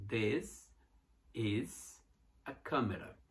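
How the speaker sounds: this, is, a camera split by pauses; separated words for learners to follow. Shown as a demonstration. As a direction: separate each word slowly. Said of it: "tudo junto".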